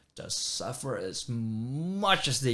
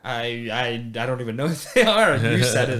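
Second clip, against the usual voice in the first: ordinary delivery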